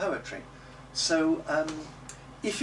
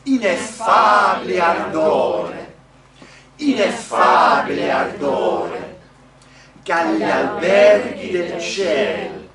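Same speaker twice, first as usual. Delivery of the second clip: slow, booming Italian recitation; loud declaimed model; 12 dB louder, slower than usual. Shown as a demonstration. Coach giving singers the Italian to repeat, loud and declaimed. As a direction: project each phrase, stretching the stressed vowels. Coach leads the whole thing.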